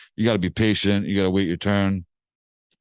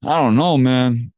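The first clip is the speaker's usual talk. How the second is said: high and louder, unsure; doubtful drawl; 4 semitones higher, 6 dB louder, slower than usual. Retold or imitated. Rapper hesitates over the old tracks, unsure and louder. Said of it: "And he's like".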